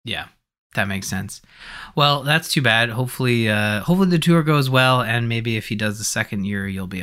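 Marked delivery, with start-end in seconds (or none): none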